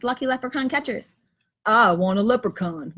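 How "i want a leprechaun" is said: Gus's voice. Acted. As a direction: lower voice for Gus. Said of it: "Gus says".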